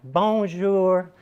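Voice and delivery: mock a Southern belle's clumsy French "Bonjour"; terrible French accent. Imitating a Southern belle's bad French "Bonjour" in a clumsy Southern-American French accent. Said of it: "had terrible French accent".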